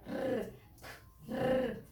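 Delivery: "r" as a rolled trill; letter sound. Shown as a demonstration. Teacher gives children the R sound, trilled. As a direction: roll the "r" on its own. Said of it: "y la R".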